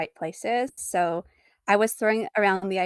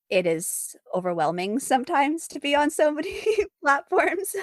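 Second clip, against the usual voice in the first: laughing it out, higher as it goes; real laugh breaking through the words; 7 semitones higher than usual. Real, not acted. Guest laughing to host about too many platforms, laughing.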